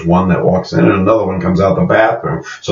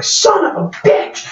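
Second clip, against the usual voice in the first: losing it; slower than usual. Retold or imitated. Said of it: "she immediately loses it".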